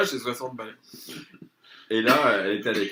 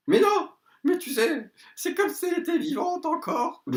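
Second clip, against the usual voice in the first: very high, squeaky put-on protest; mocking high voice; 6 dB louder than usual. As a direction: caricature the friend, pitched way up. Imite l'amie qui proteste. (voix de fausset moqueuse)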